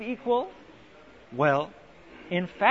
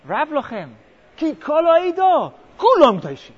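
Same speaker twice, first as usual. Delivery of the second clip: Korach's rallying cry; 4 semitones higher, 8 dB louder than usual. Retold or imitated. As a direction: rouse the crowd like a demagogue. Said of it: "and he also says".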